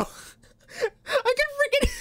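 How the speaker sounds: high helpless laughing squeal, words stretched out slowly; real laughter breaking through the words. Real, not acted. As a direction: try to speak while laughing too hard. Squealing laugh after hearing clip.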